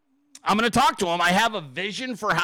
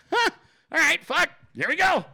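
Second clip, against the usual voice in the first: nervous excitement; 12 semitones higher than usual. Retold or imitated. Retelling his nervous reaction.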